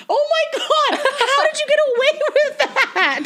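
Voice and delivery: high, laughing squeal of disbelief; giggling, incredulous and loud. Retold or imitated. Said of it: "And I'm like".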